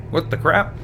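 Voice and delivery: normal talking voice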